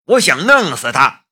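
loud, high furious yell; shouted rage. A narrator's reading. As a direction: shout the wish to kill. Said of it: "他吼道".

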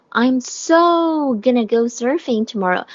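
SO stretched long and emphatic; stress on SO. Shown as a demonstration. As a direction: lean hard on a drawn-out "so". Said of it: "是一个重读的啊".

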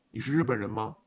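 low, loud, formal question; hotel man role. A narrator's reading. Uniformed hotel man questioning an arriving guest, low-voiced. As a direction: ask it low and firmly, as hotel staff.

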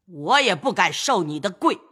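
high, sharp lecture from an elder; old lady scolding. Acted. Grandmother lecturing kneeling grandson, scolding. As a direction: keep berating him, then bargain. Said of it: "贾母又道".

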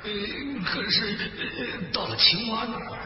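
hesitant, faltering tiger voice; scared tiger. Acted. Tiger afraid fox will abandon him, hesitant worried voice. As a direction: stammer your doubts timidly. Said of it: "老虎还是挺害怕的".

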